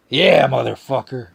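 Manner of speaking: loud, drawn-out hype yell; hyped shout. Real, not acted. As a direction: cheer it like a hype man.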